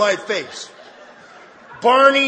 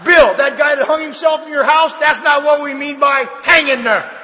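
higher, louder mock reprimand; mock scolding; 5 semitones higher, 5 dB louder than usual. Members imagine correcting Bill about sponsoring, mock scolding. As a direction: tell Bill off in mock exasperation.

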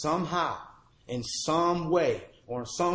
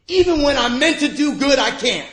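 high, loud cry of defeat; a broken man shouting his verdict on himself; anguished cry; 10 semitones higher, 10 dB louder than usual. Retold or imitated. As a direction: cry it out in despair. His despairing conclusion cried out.

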